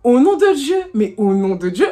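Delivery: mock-indignant oath; swearing to God. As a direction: protest your innocence loudly. Swearing she didn't see him.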